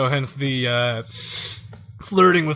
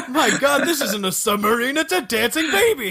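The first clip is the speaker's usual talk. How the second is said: laughing; 7 semitones higher than usual. Real, not acted. Laughing at the boss design.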